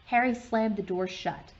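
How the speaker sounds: ordinary delivery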